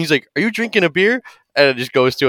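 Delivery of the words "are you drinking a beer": high-pitched disbelief, rising at the end; incredulous question. Acted. Counselor hears a beer snap open, incredulous. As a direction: question it like you cannot believe it.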